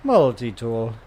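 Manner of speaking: flat robotic echo of the suit. Retold or imitated. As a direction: copy the suit computer, deadpan. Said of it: "All the little robot voices".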